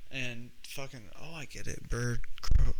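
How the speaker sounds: ordinary delivery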